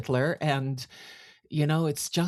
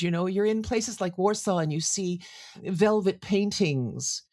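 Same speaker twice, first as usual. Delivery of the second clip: ordinary talk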